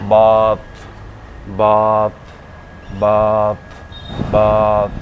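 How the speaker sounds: बात called out loud with a held "aa"; loud, separate model takes of बात, long vowel; long aa sound. Shown as a demonstration. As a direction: stretch बात into a long "baath". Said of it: "इसको हम बोलते हैं".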